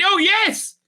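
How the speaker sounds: very high, loud "yes" of glee; eager acted reply. Retelling the actors' delighted agreement, mock-excited. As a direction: imitate someone jumping at an offer.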